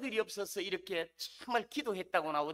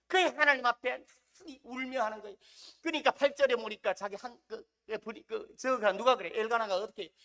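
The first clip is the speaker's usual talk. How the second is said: ordinary delivery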